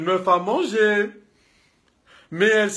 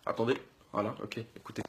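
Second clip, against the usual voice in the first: forced calm; 12 semitones lower, 18 dB quieter, faster than usual. Acted. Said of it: "je suis très énervé".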